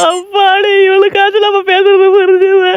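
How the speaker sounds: high put-on baby wail; baby crying. Baby thinking it is finally understood, mock crying. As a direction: keep bawling while speaking. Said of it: "ஏன் அழுகுறான்?".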